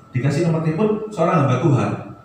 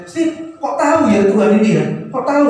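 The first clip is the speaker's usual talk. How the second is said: higher-pitched imitation of astonishment; retold amazement; 8 semitones higher than usual. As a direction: marvel in the woman's voice, pitched up. Retells woman's amazement at verse. (menirukan rasa takjub)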